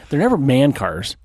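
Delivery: ordinary talk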